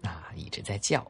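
ordinary delivery

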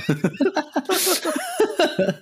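sudden squealing laugh; high laugh burst. Real, not acted.

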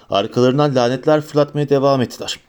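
plain reading voice

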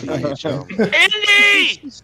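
loud, high, drawn-out shout; excited call-out. Real, not acted. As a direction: holler the name in greeting. Calling out to friend joining.

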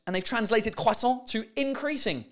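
normal talking voice

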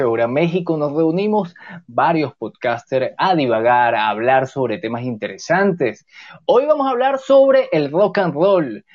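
ordinary talk, nothing marked

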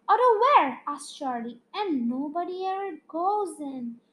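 lively storytelling read aloud; animated children's story reading; story narration. A narrator's reading. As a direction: read it like a bedtime chapter book. Reads a story chapter aloud.

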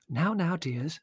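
high-voiced gentle chiding; placating mother. A narrator's reading. Mother calming her quarrelling children, placating. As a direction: soothe the squabblers without raising volume.

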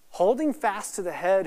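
ordinary talk

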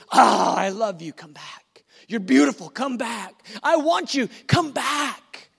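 longing plea to come back; Jesus pleading. Acted. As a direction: call the wanderer home. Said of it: "his word to you is".

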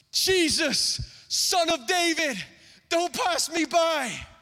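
high, slow, desperate plea; desperate cry. Acted. Blind man crying to Jesus, desperation in his voice. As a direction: beg him not to walk past. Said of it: "Desperation in his voice".

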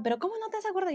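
high-pitched mimicked questioning; exasperated mother. Mother questions forgetful daughter, high exasperated imitation.

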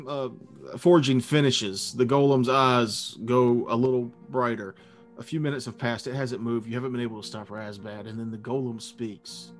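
ordinary talk

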